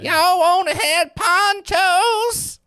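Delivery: put-on shrill, drawn-out old hag screech. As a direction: screech it like a cackling crone. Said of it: "I'm sure she sounds like an old hag".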